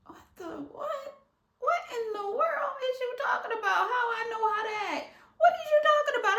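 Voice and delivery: high, fast, cracking incredulity; mock outraged laughing squeal; laughing disbelief.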